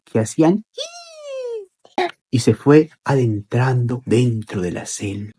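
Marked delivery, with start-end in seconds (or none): 0.7-2.1 very high, thin cricket noise; soft squeaked insect sound; cricket chirp imitation. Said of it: "el sonido de los grillos".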